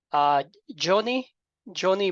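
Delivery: usual speaking voice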